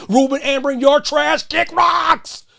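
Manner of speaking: yelling at the coach, high and loud; shouting at Ruben Amorim in frustration; angry shout. Real, not acted. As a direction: blast him, furious. Ranting at the coach.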